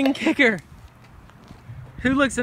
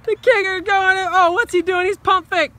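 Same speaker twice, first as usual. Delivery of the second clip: excited play-by-play announcer; sports commentary; 4 semitones higher than usual. Pretend. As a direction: call it like a live game. Mock play-by-play of kids' game.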